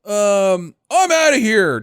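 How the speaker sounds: high, loud whiny jealous voice; mock jealous pout. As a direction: sulk and storm off in a high whine. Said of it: "And he's like".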